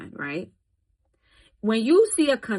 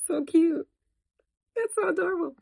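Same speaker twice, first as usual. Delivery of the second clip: much higher cooing over a cute picture; 10 semitones higher than usual. Real, not acted. Admiring the pictures she put up, high cooing.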